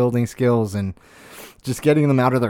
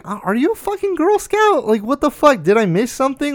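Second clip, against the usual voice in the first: much higher, louder mocking voice; mock incredulous retort; 12 semitones higher, 3 dB louder than usual. Ridiculing an adult bragging about cookie sales, in a high mocking voice. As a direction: squeal the question in mock disbelief. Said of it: "And it's like".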